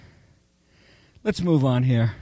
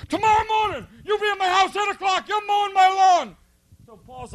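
much higher, strained mock yell at a recruit; screaming drill instructor; 13 semitones higher than usual. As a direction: squeal the threat like a furious sergeant. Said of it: "So he goes".

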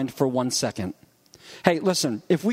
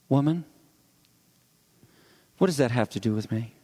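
softer, lower and quick; nicer, softer version; 6 semitones lower, faster than usual. Shown as a demonstration. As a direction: say it gently, still a bit curt. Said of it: "it still is a little curt, but it's nicer".

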